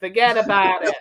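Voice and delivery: badly faked New York accent, breaking into a laugh. Shown as a demonstration. Host hams up a catchphrase in a botched New York accent. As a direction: have a half-laughing stab at New York talk. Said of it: "can we do a New York accent?".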